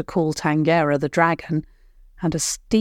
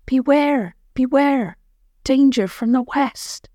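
a lightly voiced eerie warning, higher with falling "beware"; strange voice; 7 semitones higher than usual. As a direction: warn like a mysterious forest spirit. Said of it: "It called out in a strange voice".

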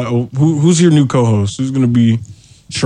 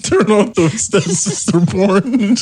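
slow, higher, laughter-strained voice; laughing punchline; 5 semitones higher, slower than usual. Real, not acted. Friend adding last cover-up step, helpless with laughter. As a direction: squeeze the punchline out through laughter.

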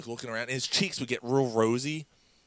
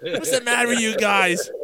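high, loud imitated telling-off; exasperated scolding; 9 semitones higher, 7 dB louder than usual. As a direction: yell at the pranksters, mimicking exasperation. Dave scolds the pranksters.

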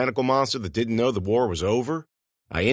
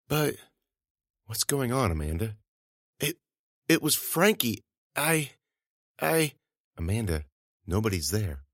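hushed shaken; 6 dB quieter than usual. A narrator's reading.

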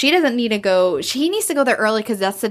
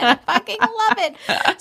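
high, slow squeal of love; squealing delight; 11 semitones higher, slower than usual. Real, not acted. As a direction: stretch it out, thrilled and gushing. Gushing over the book.

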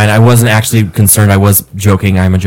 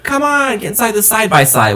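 eager urging; 10 semitones higher, 4 dB quieter than usual. Retold or imitated. Retells friend urging him aboard.

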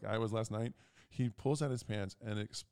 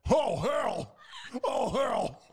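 high, loud mock shout; father's shout; 14 semitones higher, 10 dB louder, much slower than usual. Father opening the fridge, a high mock shout. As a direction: bellow it as the shocked father. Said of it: "he went".

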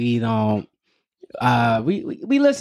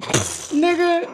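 mock exasperated kid. Daughter's exasperated reaction.

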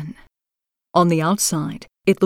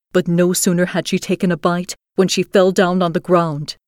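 ordinary delivery, nothing marked

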